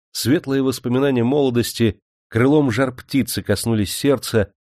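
plain reading voice

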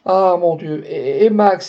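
Geneviève fears for Max, crying out; anguished cry. A narrator's reading. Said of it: "s'écria Geneviève".

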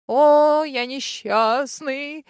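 a loud, drawn-out mock wail; hammed-up lament. Dragon moaning theatrically to the heroine, mock lament. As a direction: ham up the suffering, very slow. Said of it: "продолжал страдать золотой дракон".

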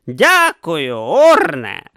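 loud, dry sarcastic thanks. A narrator's reading. Didactylos mocking Urn, loud and sarcastic. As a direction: thank him with heavy irony.

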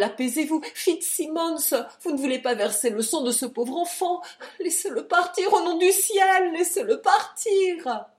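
high, loud entreaty; desperate wife stepping between duellists; frantic pleading. A narrator's reading. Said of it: "avec un cri".

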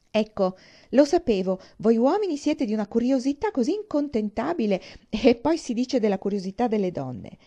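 loud, high theatrical reproach; mock scolding. Isabella teases James about men's curiosity, mock scolding. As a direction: exclaim in exaggerated exasperation at the men.

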